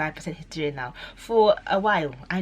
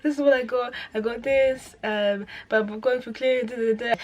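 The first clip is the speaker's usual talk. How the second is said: upset; 7 semitones higher, 4 dB louder than usual. Retold or imitated. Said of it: "I was like".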